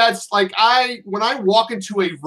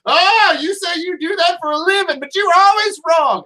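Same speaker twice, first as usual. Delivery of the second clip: higher-pitched mock Southern accent for Christina; 8 semitones higher than usual. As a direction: play his wife with a teasing Southern accent, pitched up. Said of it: "why she has a Southern accent".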